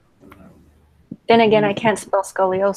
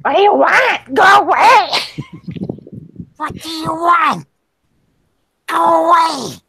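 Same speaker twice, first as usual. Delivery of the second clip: high, loud hissing creature; shrill Gollum voice; 8 semitones higher, 5 dB louder than usual. Pretend. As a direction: snarl like a possessive creature. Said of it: "How would Kara answer?".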